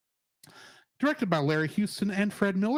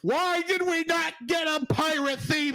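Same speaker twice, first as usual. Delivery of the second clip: exasperated outburst; 7 semitones higher than usual. Real, not acted. Outburst over the missing pirate theme.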